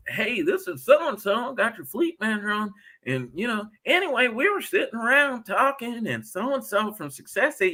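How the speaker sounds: high-pitched mock Southern fleet manager; attempted Southern accent. Fleet manager's office calling a driver about his truck, hammed-up Southern accent. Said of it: "a Southern accent".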